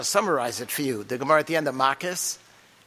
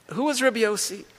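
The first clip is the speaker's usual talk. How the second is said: the repeated question pitched much higher; higher emphatic rhetorical question; 8 semitones higher than usual. Shown as a demonstration. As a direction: ask it again, higher and insistent, pressing the point.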